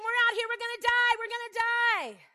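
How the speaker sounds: high, loud frantic wail; panicked shouting. Acted. Israelites at the sea, wailing loud and high. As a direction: keep shouting in terror.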